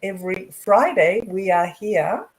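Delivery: usual speaking voice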